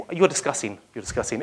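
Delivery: a hint of sad murmuring; mock sad. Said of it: "in your sad manner".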